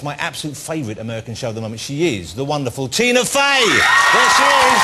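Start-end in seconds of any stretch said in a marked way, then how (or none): 2.9-4.8 loud, high, drawn-out welcome; big showbiz shout; rousing guest introduction. Real, not acted. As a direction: announce the star to a cheering crowd. Host announces guest to audience.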